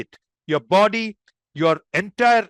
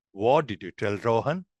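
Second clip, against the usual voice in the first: low, soft senior asking a plain question; matter-of-fact; 8 semitones lower, 4 dB quieter than usual. Shown as a demonstration. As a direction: ask it as a senior, matter-of-factly. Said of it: "a senior asking matter of fact".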